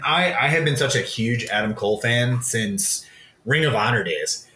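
usual speaking voice

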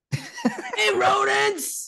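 high mock yell; imitating a shout. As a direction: shout it like an apocalypse warrior. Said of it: "just gweiddi".

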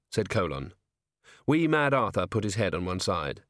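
ordinary talk

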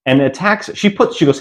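usual speaking voice

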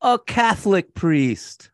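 high, slow punchline reveal; speeding up to reveal. Shown as a demonstration. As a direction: land the big reveal slowly. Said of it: "wait for it".

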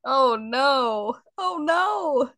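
high wailing oh no, half-laughing; mock dismay. Real, not acted. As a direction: play the dilemma as mock panic. Dilemma in kiss marry kill.

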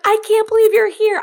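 high, loud burst of amazement; excited disbelief. Retold or imitated. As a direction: exclaim it like an overjoyed reunion. Retold joyful shouted reunion on beach.

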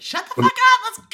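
high, loud mock shriek of a fed-up girl; bratty girl shouting. Imitating the girl as she shrieks at her lover to come on. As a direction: shriek it like an impatient girl dragging him along. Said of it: "She's like".

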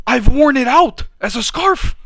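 loud, high-pitched proud declaration; worked-up boasting. Real, not acted. Boasting to friend of his Italian flag, worked up. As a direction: proclaim it at the top of your range.

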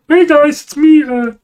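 a so-so try at Kermit; Kermit the Frog voice. Retold or imitated. Showing his friend a Kermit impression he admits is weak. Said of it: "I can't do Kermit the Frog as well, but I tried".

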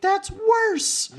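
very high, loud outburst; mock panic. Imitating Wendell's panicked retort.

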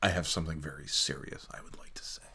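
mock-serious sports radio host, dropping to a whisper; dramatic radio host. Parodying sports radio going dramatic, mock-serious hush. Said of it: "what they do on sports radio when it gets really dramatic".

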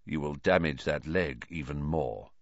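low, soft warning; hushed, deep villain voice; quiet. A narrator's reading. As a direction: advise him quietly, deep down. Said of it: "he said quietly to Ron".

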